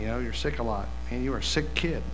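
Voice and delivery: ordinary talk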